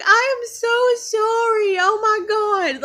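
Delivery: high, loud drawn-out apology breaking with laughter; apologizing through laughter. Retold or imitated. Teller to boy lifted off the ground, mortified laughing apology. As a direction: wail it, half laughing, stretched out. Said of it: "I started laughing".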